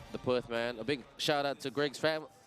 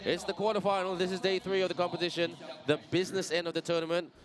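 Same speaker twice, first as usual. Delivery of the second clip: excited; 6 semitones higher, faster than usual. Real, not acted. Commentator to viewers at the quarterfinal, excited and fast. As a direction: hype up the quarterfinal. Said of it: "I'm so excited".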